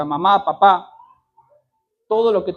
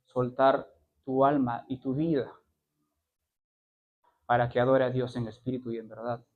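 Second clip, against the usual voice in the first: pleading softly; 6 semitones lower, 3 dB quieter than usual. Acted. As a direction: draw them in gently. Soft appeal.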